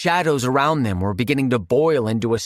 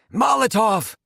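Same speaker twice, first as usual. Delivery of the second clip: rasping shout; 9 semitones higher than usual. Acted. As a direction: shout it through a crushed throat.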